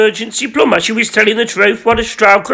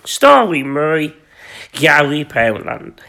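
slow; 7 semitones lower, slower than usual. Shown as a demonstration. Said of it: "This is Fifty Shades of Gary".

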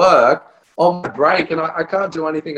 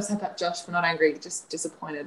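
much softer, lightly voiced disappointed officer; disappointed cop; 12 dB quieter than usual. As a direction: scold him softly like a let-down parent. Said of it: "Imagine if the cops had a".

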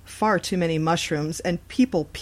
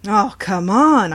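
complaining; 3 semitones higher, 8 dB louder, much slower than usual. A narrator's reading. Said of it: "I complained".

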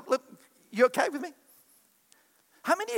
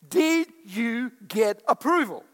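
ordinary talk, nothing marked

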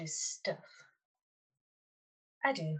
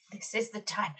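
ordinary talk, nothing marked

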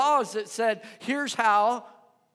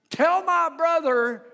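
rude interrupter; 6 semitones higher, 6 dB louder than usual. Acted. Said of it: "this guy says".

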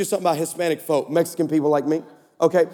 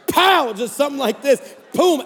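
ordinary talk

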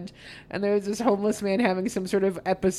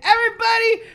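announcer shout; 13 semitones higher, 10 dB louder than usual. Retold or imitated. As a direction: bellow it like an emcee. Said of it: "he's like".

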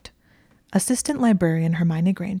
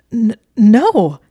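stammered, much louder, pitched up protest; 5 semitones higher, 12 dB louder than usual. Acted. Draco denying the accusation, flustered loud protest. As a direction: blurt a flustered denial. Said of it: "he protested".

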